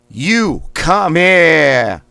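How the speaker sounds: You come here slow, high and loud, here as Boston "hiah"; Boston accent. Shown as a demonstration. Coach leading Boston warm-up, slow loud Boston You come here. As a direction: stretch it out in Boston with a dropped R. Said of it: "It's gonna be in a Boston accent".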